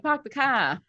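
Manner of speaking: Boston "park the car", r's dropped; Boston accent. Shown as a demonstration. Voice actor comparing accents, Boston "park the car". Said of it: "sounds more like Boston".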